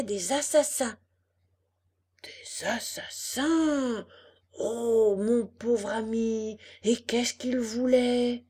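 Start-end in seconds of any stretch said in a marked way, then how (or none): 2.2-4.1 slow, drawn-out horrified echo; aghast fox. Acted. Fox echoes Pinocchio's news of assassins, theatrically aghast. 4.6-8.4 slow, loud theatrical sympathy; pitying fox. Acted. Fox commiserates with his poor friend, hammed-up pity. Fox pities the robbed puppet.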